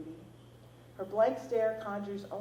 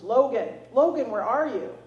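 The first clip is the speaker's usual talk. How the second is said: booming call; 4 semitones higher, 12 dB louder than usual. A narrator's reading. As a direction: boom his name across the woods. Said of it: "a man's voice booms".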